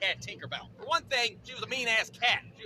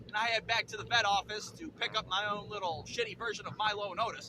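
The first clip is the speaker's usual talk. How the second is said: normal talking voice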